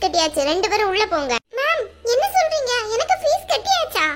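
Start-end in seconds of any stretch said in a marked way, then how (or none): 1.6-4.1 high-pitched startled questioning; astonished. Acted. Student hears from teacher her fees are paid, astonished. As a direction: ask in stunned surprise, pitch jumping up.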